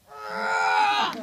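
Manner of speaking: deep, loud roaring grunt as he pulls the bars apart; huge low beastly roar of effort; gorilla roar. Acted. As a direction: bellow it like an angry gorilla. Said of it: "he's like".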